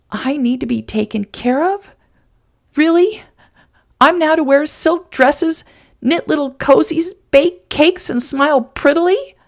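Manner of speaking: mock his words back at him, scornful; sneering. Woman mocking the man's idea of a female. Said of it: "Sam said with a sneer".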